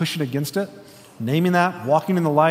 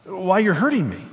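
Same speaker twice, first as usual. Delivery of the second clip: higher, hurt protest; pained ghost; 5 semitones higher than usual. Acted. Ghost protesting to the angel, pained and higher. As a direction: cry out that it hurts.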